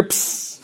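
quick p burst into a hiss; balloon-popping hiss. Shown as a demonstration. Teacher fixing the psi sound in memory, hissed ps. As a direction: make the sound of a punctured balloon. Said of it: "That's the noise".

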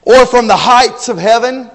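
loud, high, forceful preaching; fiery preaching. Acted. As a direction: cry it out like a revival preacher. Preacher imagining Ahaz's prayer.

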